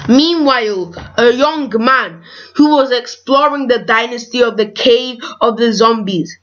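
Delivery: ordinary delivery, nothing marked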